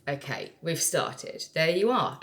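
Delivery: normal talking voice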